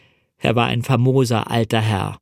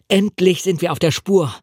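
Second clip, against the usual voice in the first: a lifted, eager cry of discovery; excited call; 6 semitones higher than usual. Acted. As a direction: call it out, thrilled to be on the trail. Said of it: "rief ich aufgeregt". (aufgeregt ausgerufen)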